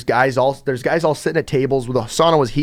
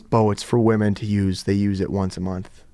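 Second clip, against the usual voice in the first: a hint of matter-of-fact guide; 6 semitones lower, 3 dB quieter than usual. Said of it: "He goes".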